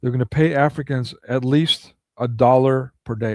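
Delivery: normal talking voice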